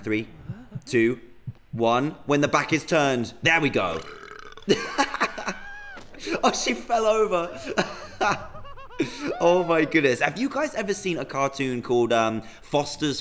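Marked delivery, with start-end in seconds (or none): 4.0-6.4 airy high-pitched giggle; a wheezy laugh at her fall; breathy high giggle. Real, not acted. NPC falls over. 7.6-9.3 high giggle. Real, not acted. NPC falls over.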